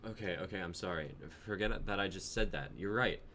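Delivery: calm her down gently; soothing. Acted. Jonah backing down to calm his girlfriend, soothing.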